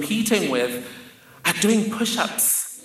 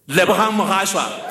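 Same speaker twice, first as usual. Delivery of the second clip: announcer call; 3 semitones higher, 3 dB louder than usual. Retold or imitated. Host announcing the pageant winner, loud proud call.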